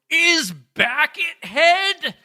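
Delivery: high, slow, laughing question. Retold or imitated. Reading comment as question.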